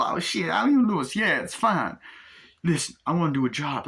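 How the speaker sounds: high-pitched street-hustler voice; film-character impression. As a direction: sell the job like a pushy hustler. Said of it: "doing the impressions of the, uh, actors".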